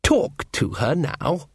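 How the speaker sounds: slow, drawn-out elf, lightly voiced; a lightly played sly elf, slow; cold elf voice. Elf mocking Sean, slow and cold.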